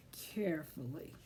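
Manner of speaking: hushed, careful whisper. A narrator's reading. Reader to children, whispering the word carefully.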